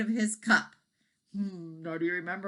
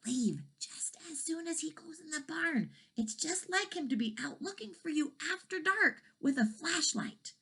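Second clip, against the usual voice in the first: whispered, higher cow warning; quiet warning; 10 semitones higher, 5 dB quieter than usual. A narrator's reading. Cows warning the dragon, whispered warning. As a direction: whisper it urgently. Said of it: "they quietly warned the dragon".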